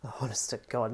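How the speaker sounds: breathy whispered groan; exasperated whisper. Real, not acted. Weary whispered groan at the co-host's teasing.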